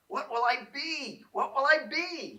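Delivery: high, eager repeated question; eager repeating. A narrator's reading. Little Tree asking himself as the old man works, in high eager voice. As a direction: bounce with excitement. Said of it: "he said over and over".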